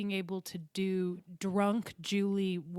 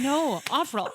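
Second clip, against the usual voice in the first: imitated Irish correction, higher and louder; Irish accent; 6 semitones higher, 6 dB louder, slower than usual. Classmate insisting on her name, exasperated Irish accent. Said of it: "was Irish and very cool".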